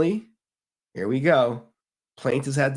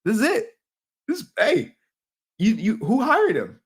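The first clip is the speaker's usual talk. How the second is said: much higher, louder, an excited rush of disbelief; excited exclamation; 8 semitones higher, 4 dB louder than usual. Retold or imitated. Caught-red-handed reaction, higher and excited. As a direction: yelp it out like you just caught them. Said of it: "I'm like".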